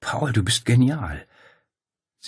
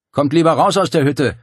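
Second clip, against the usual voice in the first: higher, louder call across the plateau; 8 semitones higher, 8 dB louder than usual. A narrator's reading. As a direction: shout to be heard over rotors. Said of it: "rief er".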